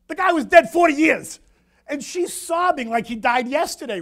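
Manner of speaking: high, loud astonished outburst. Real, not acted. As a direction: blurt it out in disbelief. Astonished at forty-year grief.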